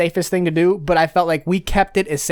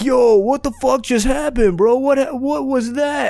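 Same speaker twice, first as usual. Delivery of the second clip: much higher, slowed, dazed buddy voice; friend's dazed voice; 9 semitones higher than usual. Retold or imitated. Friend waking in back seat asks what happened, high dazed voice. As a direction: play the friend popping up confused from the back seat. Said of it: "He's like".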